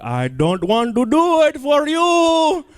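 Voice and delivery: high, slow put-on seductive voice. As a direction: drag it out in a silly high voice. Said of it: "I do that thing you like".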